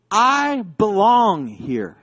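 very slow, emphatic declaration; standing his ground. Retold or imitated. Student holding up his passport, slow emphatic stand. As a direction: stretch each word out as a firm claim. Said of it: "how he stood his ground".

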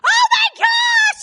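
very high, loud, drawn-out squeal; overjoyed shriek. Acted. Rhoda hears Peter at the door, overjoyed. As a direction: shriek with hammed-up joy. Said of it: "she gets so overjoyed".

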